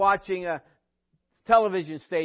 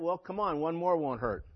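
sarcastic; 2 semitones lower, 5 dB quieter than usual. Retold or imitated. Preacher retells his reply to a church hypocrite complaint, sarcastic. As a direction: toss it off softer, deadpan. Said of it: "But that's my sarcasm".

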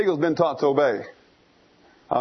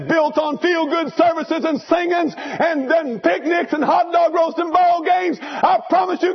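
preaching at full force; 9 semitones higher than usual. Acted. Preacher warning the congregation about feel-good faith, fervent preaching. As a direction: hammer the point home.

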